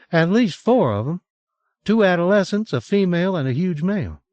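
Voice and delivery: high, bright-toned Southern drawl, lightly voiced; a hint of a Southern twang, upbeat; a woman announcing bear news in Southern drawl. A narrator's reading. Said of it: "her southern drawl".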